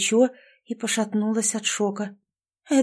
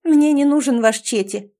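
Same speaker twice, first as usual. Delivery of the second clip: louder, higher, stress on "мне"; haughty snort; 4 semitones higher, 6 dB louder than usual. A narrator's reading. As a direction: sniff it out disdainfully. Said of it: "фыркнула я". (героиня надменно фыркает на мужчину, громко)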